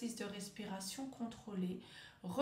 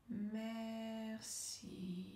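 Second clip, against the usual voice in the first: MERCI very slow and soft, both syllables held level; stretched syllables; 6 dB quieter than usual. Shown as a demonstration. Coach leads word-smoothing exercise after a breath, drawn-out MERCI. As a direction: draw out MER, then a long soft CI. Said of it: "allonger le mot, allonger la syllabe".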